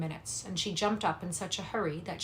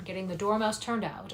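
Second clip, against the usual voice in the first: ordinary delivery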